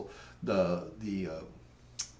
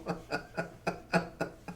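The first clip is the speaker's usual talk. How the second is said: long, helpless run of laughter; higher-pitched genuine chuckling; real laugh; 7 semitones higher, 4 dB quieter than usual. Real, not acted. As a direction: keep laughing after the punchline. Laughs on after the punchline.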